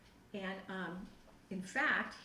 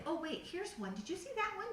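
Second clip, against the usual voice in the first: eager; 9 semitones higher than usual. Retold or imitated. Eagerly pointing out apartments.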